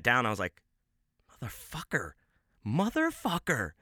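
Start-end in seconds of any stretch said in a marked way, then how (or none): 1.4-2.1 muttered curse. Retold or imitated. Cursing friend after performance. 2.7-3.7 slow, higher, stretched-out exasperation; drawn-out curse. Retold or imitated. Cursing friend after performance.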